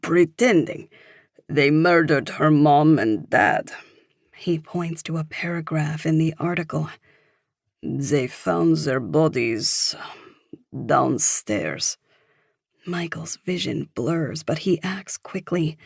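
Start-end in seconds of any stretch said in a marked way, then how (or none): 0.0-3.7 put-on older Frenchman's accented English; emphatic, French-accented explaining; thick French accent. A narrator's reading. Older man finds the word he wanted, thick French accent. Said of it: "he says with a thick French accent". 7.8-12.0 put-on slow, lower French-accented voice; older Frenchman, slow and low; thick French accent. A narrator's reading. Older man reads out grim news, slow thick French accent. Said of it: "he says with a thick French accent".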